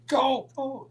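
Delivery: high, frantic imitated urging; panicked urging. Ole hitting the seat for Roddy to go, panicked. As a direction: urge the driver on in high alarm.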